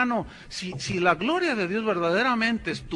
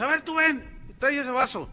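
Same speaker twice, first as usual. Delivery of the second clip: calling out a volunteer; 7 semitones higher than usual. Retold or imitated. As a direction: summon the helper up front.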